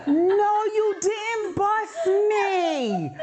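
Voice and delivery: very high, slow, drawn-out laughing wail; a slow laughing wail of mock outrage; laughing cry. Real, not acted. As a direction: wail it through laughter, stretched out.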